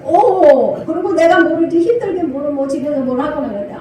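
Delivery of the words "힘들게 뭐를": ordinary delivery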